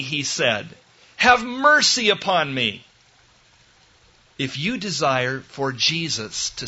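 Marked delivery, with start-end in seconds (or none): 1.2-2.8 raised, imploring cry. Acted. Preacher repeating Bartimaeus's plea to Jesus, crying out. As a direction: plead loudly at a high pitch. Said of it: "When Bartimaeus cried out".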